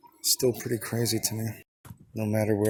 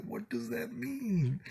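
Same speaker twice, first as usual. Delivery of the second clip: much higher, slow bewildered question; confused question; 13 semitones higher, much slower than usual. Retold or imitated. Him asking the girl what her reaction meant, high and slow. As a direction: squeak it out, baffled. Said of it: "And I was like".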